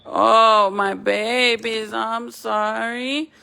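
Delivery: ordinary talk, nothing marked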